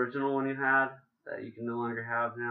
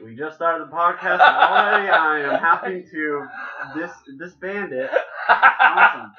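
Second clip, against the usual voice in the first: laughing, higher and louder; 5 semitones higher, 11 dB louder than usual. Real, not acted. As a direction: laugh through a mock complaint. Laughing through mock complaint.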